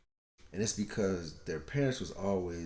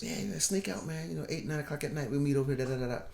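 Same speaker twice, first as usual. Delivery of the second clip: put-on higher, fast, eager teenage hustler voice; cocky teen self; 6 semitones higher, faster than usual. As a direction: play the sneaky fourteen-year-old setting up a late-night meet. Acting out his teen self with girls.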